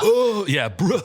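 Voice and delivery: high, loud mocking "bruh" whine; whiny complaint. Mimicking kids annoyed at the safety video, high whiny complaint. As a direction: mimic exasperated kids, hammed-up. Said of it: "the reaction that they gave".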